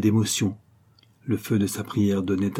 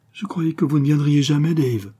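high-pitched exclaimed greeting; eager cry of welcome; little cry; 5 semitones higher, 4 dB louder than usual. A narrator's reading. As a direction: call it out, pitched up from the start. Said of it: "un petit cri l'accueillit".

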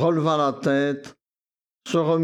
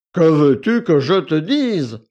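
louder, curt impatient man; impatient retort; 7 dB louder than usual. A narrator's reading. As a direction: brush her off impatiently. Victor répond sèchement à Akoulina. (réplique impatiente, plus forte)

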